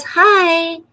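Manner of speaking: high, loud little greeting; Bee Lady voice. Acted. Bee Lady toy greeting Sosa in a high, loud voice. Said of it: "She says".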